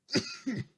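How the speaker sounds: a sharp single cough. Acted. Host apologising to listeners on air, a sharp cough. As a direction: clear the throat with a hard cough.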